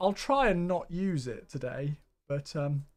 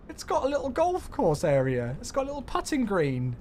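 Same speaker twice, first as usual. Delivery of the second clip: normal talking voice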